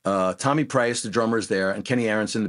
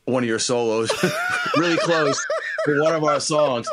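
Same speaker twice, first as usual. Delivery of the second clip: giggling while admitting a guilty secret; laughing through it; 6 semitones higher than usual. Real, not acted. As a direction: laugh your way through the confession. Laughing while confessing lifted solo.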